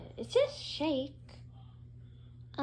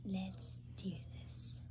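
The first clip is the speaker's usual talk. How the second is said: slow, hushed resolve; whisper; 9 semitones lower, 18 dB quieter, much slower than usual. Acted. About to fix the hiccups, slow whisper. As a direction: whisper it slowly, barely audible.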